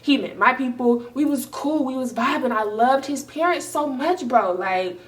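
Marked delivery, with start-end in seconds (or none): none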